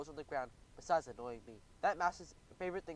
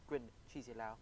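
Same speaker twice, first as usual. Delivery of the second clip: ordinary delivery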